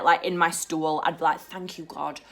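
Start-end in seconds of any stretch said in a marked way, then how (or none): 1.4-2.2 hushed, grateful mock prayer; murmured prayer. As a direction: pray softly under your breath. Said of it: "I'd literally say a prayer".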